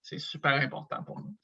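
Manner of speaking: normal talking voice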